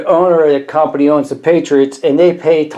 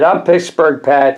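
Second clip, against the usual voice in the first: ordinary talk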